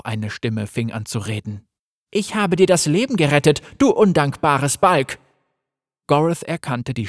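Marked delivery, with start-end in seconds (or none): 2.1-5.2 scolding mother. Acted. Mother scolds ungrateful son.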